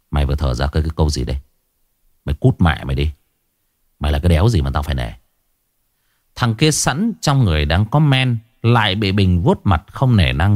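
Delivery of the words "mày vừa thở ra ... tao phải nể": low, menacing threat. Acted. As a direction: growl it low and dangerous. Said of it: "Bình vằn mắt".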